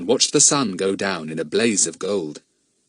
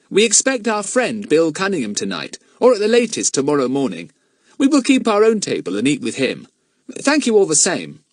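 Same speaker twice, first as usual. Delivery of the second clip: put-on higher, polite and decided boy's voice; firm but polite; 7 semitones higher, 4 dB louder than usual. A narrator's reading. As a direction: stand your ground with good manners. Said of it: "he said firmly but politely".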